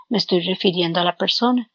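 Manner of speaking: usual speaking voice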